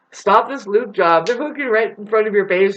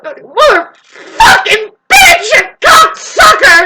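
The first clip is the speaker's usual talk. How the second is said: much higher, loud, slow screamed insults; mock furious shout; 11 dB louder, much slower than usual. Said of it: "they're all like".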